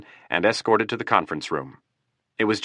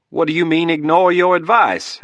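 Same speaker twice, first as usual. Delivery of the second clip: much higher, louder desperate plea; pleading; 10 semitones higher, 6 dB louder than usual. Acted. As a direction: beg for an explanation. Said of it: "in a pleading tone".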